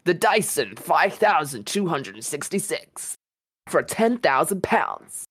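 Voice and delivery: slow, high announcer's pitch; advert voice. Shown as a demonstration. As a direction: draw it out like a product advert. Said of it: "do your advert voice".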